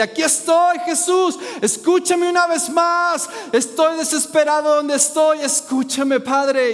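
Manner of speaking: high, loud pleading cry to God; loud desperate prayer. Shown as a demonstration. Preacher showing the congregation how to cry out, loud desperate prayer. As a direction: lift your voice and plead. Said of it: "es tiempo de levantar la voz".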